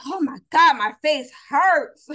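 loud, high dramatic exclamation; exaggerated complaint. Churchgoer walking out after all that smiling, exaggerated complaint. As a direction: wail it, drawing out the end.

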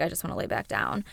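low, weary and spent, imitating her old self; worn-out sigh. Patient sitting up for the first time, low and exhausted. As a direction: mutter it like you've no energy left.

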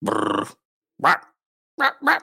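badly faked growl and barks; growl, then bark like a dog; dog-like wolfman noises. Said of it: "He's literally just like a dog".

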